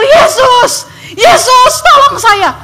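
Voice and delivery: high, loud cry for help; desperate screaming. Retold or imitated. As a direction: scream for rescue with all you have. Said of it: "Teriak-teriak nggak tahu".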